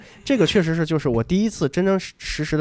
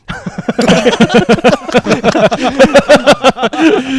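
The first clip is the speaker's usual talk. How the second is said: long, loud, high laughter; big laugh; 11 semitones higher, 12 dB louder than usual. Real, not acted. Telling the listener, laughing hard at his own joke. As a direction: break up laughing.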